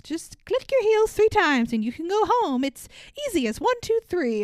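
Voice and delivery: high, sing-song fairy voice; sweet Glinda voice. Retold or imitated. Glinda tells Dorothy how to get home, high and sing-song. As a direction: coax her home sweetly. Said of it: "Glinda's like".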